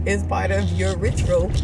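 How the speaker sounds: loud laughing delivery; bursting with laughter. Real, not acted. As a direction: laugh your way through it. Laughs after breastfeeding.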